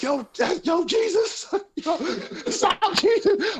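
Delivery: very high mock-frantic cries; panicked pleading. Tripping brother begging Jesus to stop chasing, mock panicked pleading. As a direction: imitate a terrified plea, half laughing.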